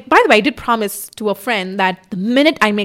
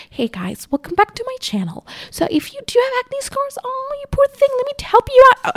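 much higher, sugary greeting voice; mock her overly nice old self; old sweet YouTuber voice. Mocking her past self greeting viewers, sugary high. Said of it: "I was so nice in that video".

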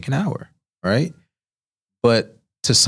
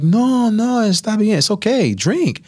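much higher, louder reassuring chef voice; cheerful chef; 13 semitones higher, 3 dB louder, slower than usual. Retold or imitated. Senior chef telling the nervous cook to relax and drink, high and bright. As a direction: wave his worries away cheerily. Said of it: "she's like".

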